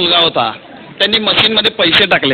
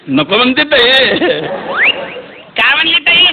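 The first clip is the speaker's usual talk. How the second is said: put-on Solapuri boy on the phone; 4 semitones higher than usual. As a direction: play the village lad chatting to his sister. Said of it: "कसा बोलत होता बघा तुम्ही".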